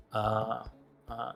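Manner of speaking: ordinary talk, nothing marked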